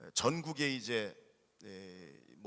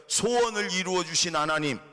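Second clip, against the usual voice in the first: louder, higher, stress on 소원을, falling at 하나님; 소원을 이루어 주신 하나님 called out louder for the church to repeat; call for the congregation to repeat; 5 semitones higher, 8 dB louder than usual. Shown as a demonstration. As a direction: lead a loud call and response. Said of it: "따라해 볼까요?".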